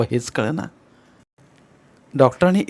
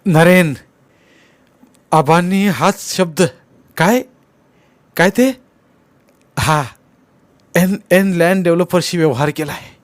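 agitated, halting and high-pitched; worked-up sick man short of breath; breathless, trembling; 6 semitones higher, 5 dB louder than usual. A narrator's reading. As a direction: struggle the words out loudly, stumbling on starts. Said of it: "बोलताना धाप लागू लागली व थरथर सुरू झाली".

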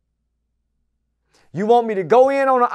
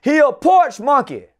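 ordinary talk

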